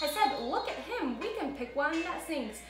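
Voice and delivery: put-on bouncy storybook rhythm for kids; lively read-aloud for children. A narrator's reading. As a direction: read it like story time for little ones. Reads Seuss story to kids.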